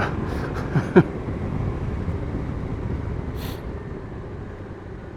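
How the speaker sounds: breathy unvoiced laughter, long and easy; real laugh. Real, not acted. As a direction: laugh it off quietly. Laughs at own answer.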